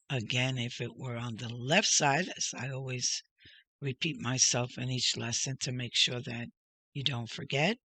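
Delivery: usual speaking voice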